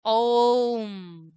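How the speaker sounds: "ओम" drawn out very long into a hum; triple-length vowel. Shown as a demonstration. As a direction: hold "ओम" three times as long as a short vowel. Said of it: "तिगुना समय ज्यादा ले रहे हैं". ("ओम" को खूब लंबा खींचकर प्लुत बोलें)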